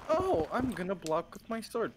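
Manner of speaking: self-quote. Retold or imitated. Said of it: "I was like".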